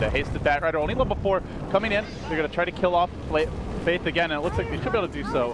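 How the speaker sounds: excited play-by-play. Acted. Caster to viewers as Batrider comes in, quick excited play-by-play. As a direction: race through the attack as it happens.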